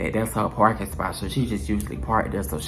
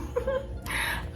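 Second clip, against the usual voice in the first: high airy giggle; soft breathy titter; 11 dB quieter than usual. Retold or imitated. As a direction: giggle like an innocent girl. Giggling acting innocent.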